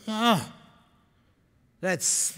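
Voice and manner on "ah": high, breath-catching "ah"; awed gasp. Acted. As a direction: gasp as the idea lands. Awed reaction to her idea.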